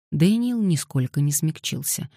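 ordinary delivery, nothing marked

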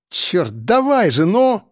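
impatient outburst rising sharply in pitch; urgent cry. Acted. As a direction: urge the plane on desperately. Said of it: "выкрикнул Серёга".

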